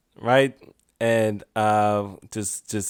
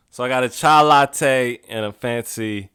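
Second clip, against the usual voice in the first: normal talking voice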